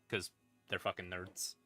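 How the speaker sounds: ordinary talk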